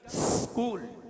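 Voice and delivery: "स्कूल" opening on a drawn-out s; long pure s into kool in "स्कूल"; correct pronunciation. Shown as a demonstration. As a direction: say "स्कूल" with no vowel before the s. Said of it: "बोलने का तरीका".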